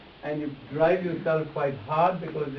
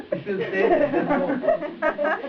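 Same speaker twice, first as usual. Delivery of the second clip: much higher and louder, very slow, laughing; laughing through words; 12 semitones higher, 6 dB louder, much slower than usual. Real, not acted. Joking to the client about her jaw, laughing. Jokes about jaws falling off.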